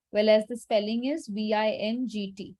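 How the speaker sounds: ordinary talk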